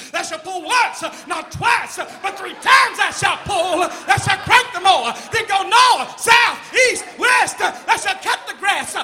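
high, shouted fire-and-brimstone chant; hammed-up fireball preacher at full force. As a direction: thunder out chores like commandments. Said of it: "with that preacher voice".

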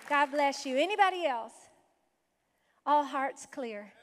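normal talking voice

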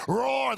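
mock lion roar. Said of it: "and his wife".

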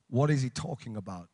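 very low, hushed congregation murmur; quiet, deep-pitched mock bewilderment; imitated puzzled aside. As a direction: drop right down, as if asking a neighbour. Voices the puzzled congregation's reaction.

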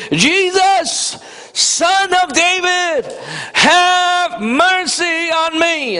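high, slow pleading cry. Retold or imitated. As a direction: call out to Jesus, drawn out and begging. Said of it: "he began to cry out and say".

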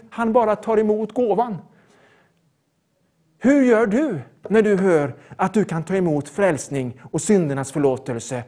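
ordinary talk, nothing marked

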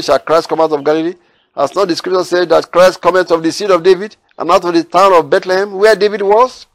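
loud, lifted Bible reading; preacher reading the Gospel aloud to his listeners, declaiming; scripture reading. Acted. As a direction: proclaim the verses to the congregation. Reads scripture verses aloud.

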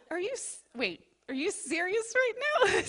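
high incredulous question with a laugh; retold astonishment breaking into laughter; amazed disbelief. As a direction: gasp it out in happy shock. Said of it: "I was like".

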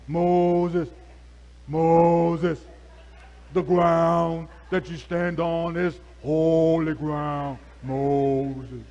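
loud, slow commanding call; solemn God voice. Retold or imitated. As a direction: intone it like the movie's voice of God. God calling Moses, film style.

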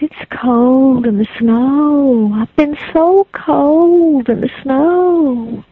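put-on slow, drawn-out ghostly child.

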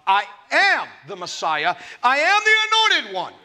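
building to a high, loud cry; rising preacher's shout as Jesus declares himself; preaching shout. Acted. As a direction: cry out the claim with full force. Preacher voicing Jesus' claim.

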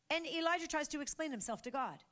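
normal talking voice

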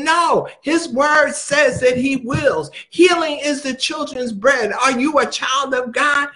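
ordinary delivery

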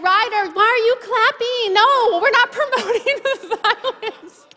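high-pitched, laughing protest; amused mock scolding. Real, not acted. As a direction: scold the crowd through a laugh. Amused scolding of clapping crowd.

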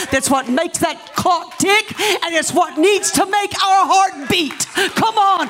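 high, ringing preacher's shout; full-force preaching. Real, not acted. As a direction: cry it out to the whole church at a climax.

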